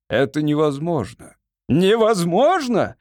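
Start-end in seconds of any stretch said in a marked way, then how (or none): 1.7-3.0 high, drawn-out incredulous exclamation; indignant cry. A narrator's reading. Lord echoes the wizard's refusal, indignant cry. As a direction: cry it back slowly, pitched up in outrage. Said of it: "вскричал лорд Фейт".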